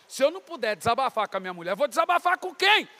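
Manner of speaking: loud retort climbing high at the end; indignant protest. Retold or imitated. Retelling his argument with God over his wife, indignant protest. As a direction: push back in exasperation, voice rising.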